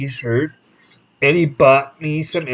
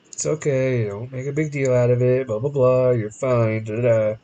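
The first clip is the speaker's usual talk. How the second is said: softer, lower, shrugging it off; dismissive dad; 5 semitones lower, 6 dB quieter than usual. Retold or imitated. Dad quietly brushing off his angry son's complaint. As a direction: play it down, wave it away.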